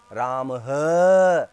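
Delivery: राम ह with a breathy h on the end, drawn out very slowly; visarga on ram. Shown as a demonstration. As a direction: stretch राम and push out a loud ह from the throat. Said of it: "बोलते हैं ना". (राम ह, विसर्ग के साथ धीरे धीरे)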